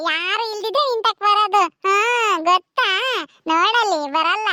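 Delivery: usual speaking voice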